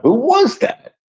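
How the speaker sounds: a mild mock yell across the room; retold yell. Calling out to find the mystery singer, amazed. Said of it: "I yell out".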